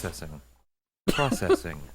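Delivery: flat synthesized robot voice. Pretend. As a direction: drone it like a computer. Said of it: "Is that a good robot voice".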